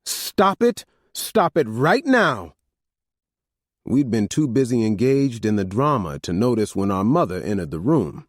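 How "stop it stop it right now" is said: high, sharp scolding order; stern command. A narrator's reading. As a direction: stop them cold like a mother. Mother halts sons' fight.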